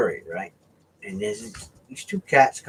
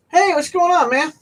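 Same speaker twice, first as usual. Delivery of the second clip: higher, louder casual hey man; bright friendly stranger; friendly greeting voice; 13 semitones higher, 4 dB louder than usual. Retold or imitated. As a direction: sound easygoing and harmless. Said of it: "when the voice changes".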